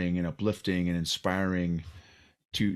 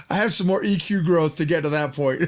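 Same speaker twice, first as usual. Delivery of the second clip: words bubbling through real laughter; laughing while talking; 6 semitones higher, 4 dB louder than usual. Real, not acted. Host admits he lacks the courage, laughing through it. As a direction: laugh it out, admitting your limits.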